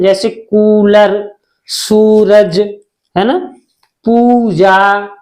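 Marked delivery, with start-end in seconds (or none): 0.3-2.6 कूलर and सूरज slowly, with a held "oo"; long-u examples. Shown as a demonstration. Teacher giving students examples of बड़ा ऊ, drawn-out oo. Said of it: "बड़े ऊ की मात्रा". 4.0-5.1 पूजा slowly, as "pooo-jaa"; long-u example. Shown as a demonstration. Teacher showing students बड़े ऊ का sound, drawn-out पूजा. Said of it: "बड़े ऊ का साउंड आता है".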